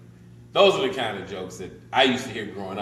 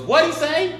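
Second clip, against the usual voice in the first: high, loud shouted question; loud, high-pitched deaf old-joke wife; hard-of-hearing wife; 8 dB louder, slower than usual. Acted. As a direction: yell it like you can't hear.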